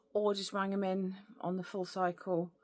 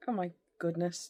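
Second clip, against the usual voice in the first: normal talking voice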